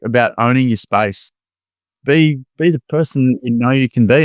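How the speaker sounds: normal talking voice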